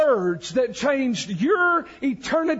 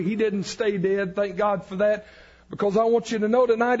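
ordinary talk

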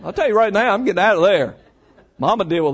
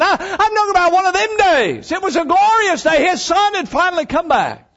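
high, loud, rising preaching; preaching at full force; 9 semitones higher, 3 dB louder than usual. Real, not acted. Preacher exulting about the glorious day of return, high and loud. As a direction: build the joy like a revival.

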